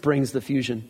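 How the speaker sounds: ordinary talk, nothing marked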